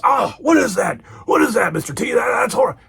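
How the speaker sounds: high, mock-disgusted outcry; Hulk Hogan. Hulk tasting Mister T's juice, disgusted impression. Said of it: "Hulk says".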